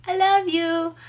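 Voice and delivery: a high, loud, heartfelt call to a friend; sincere love call. Real, not acted. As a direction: burst out with real affection. Addressing her friend watching video.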